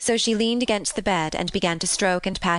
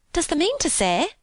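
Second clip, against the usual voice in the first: high, incredulous Yorkshire question; amazed maid; astonished cry; 10 semitones higher than usual. A narrator's reading. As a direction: ask it aghast, pitched up. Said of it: "cried Martha with wide open eyes".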